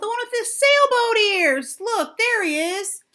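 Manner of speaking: loud high taunting; rowdy boy jeering. A narrator's reading. Rowdy boys jeer.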